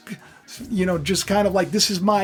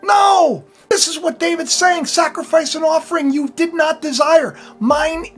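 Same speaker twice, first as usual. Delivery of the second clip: higher, louder fiery sermon; full-force preaching; 9 semitones higher, 6 dB louder than usual. Acted. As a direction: thunder it from the pulpit.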